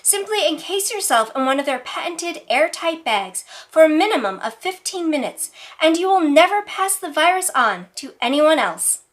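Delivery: ordinary delivery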